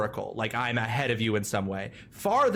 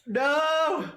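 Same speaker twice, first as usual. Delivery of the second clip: high shriek into laughter; laughing scream; 12 semitones higher than usual. Real, not acted. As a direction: squeal then laugh. Laughing at own hiccup.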